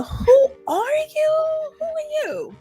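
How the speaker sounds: ordinary delivery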